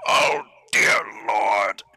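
slow, loud horrified cry; shocked cry. Acted. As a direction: drag it out in horror. Sees blood, shocked.

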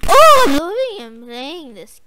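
normal talking voice

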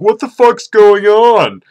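high, loud, drawn-out baffled cry; bewildered pet cats. Acted. Gamer voicing cats baffled by the screaming, bewildered cry. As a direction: voice an alarmed cat, slow and high. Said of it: "My cats are probably like".

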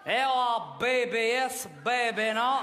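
mock accented English, maybe as baby; father's broken English. Father answers the officer in broken, accented English. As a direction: mimic dad's mangled English reply. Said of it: "Est-ce que tu sais comment il lui a dit".

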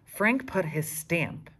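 ordinary delivery, nothing marked

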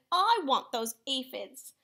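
acted grumpy insistence; grouchy demand. A narrator's reading. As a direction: stake your claim like a grouchy bug. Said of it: "shouted the grouchy ladybug".

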